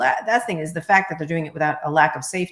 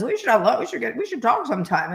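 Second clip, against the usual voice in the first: imitating Murdoch mumble; 6 semitones higher than usual. Imitating Rupert Murdoch inviting her to lunch, mumbling. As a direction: play him as an eager mumbler. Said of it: "he mumbles when he talks".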